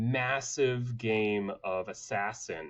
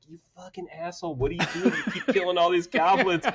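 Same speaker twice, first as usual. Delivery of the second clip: higher, fast, laughing rant; mock angry organizers; 8 semitones higher, 6 dB louder, faster than usual. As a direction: scold the player, half laughing. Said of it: "the people who run the monster village are like".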